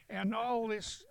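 ordinary delivery

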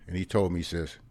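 ordinary delivery

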